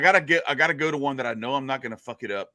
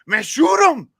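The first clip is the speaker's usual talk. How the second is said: high, loud Cajun character yell; comic full-voiced shout; shouted order; 9 dB louder, slower than usual. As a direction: bellow the order to your buddy. Joke's acted shouted order.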